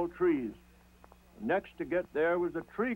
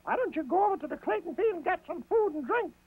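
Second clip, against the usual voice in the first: high, bright-eyed rabbit voice; Brer Rabbit; 13 semitones higher, faster than usual. A narrator's reading. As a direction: nudge them like a clever rabbit. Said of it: "asked Brer Rabbit".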